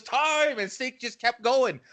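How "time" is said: calling time. Retold or imitated. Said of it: "then Malakai goes".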